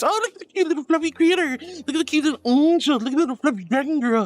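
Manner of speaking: high, quick gushing imitation; mock cooing admirers. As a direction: coo over cute animals, mockingly. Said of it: "It's all the cute, cute this".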